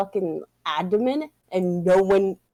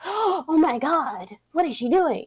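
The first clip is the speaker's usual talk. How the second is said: mock shock; 9 semitones higher than usual. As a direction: play an aghast onlooker, pitched up. Voices onlookers' shocked reaction.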